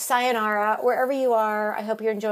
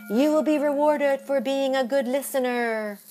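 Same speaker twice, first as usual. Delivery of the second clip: fortune-teller read; 5 semitones higher, 3 dB louder than usual. Acted. Reading fortune.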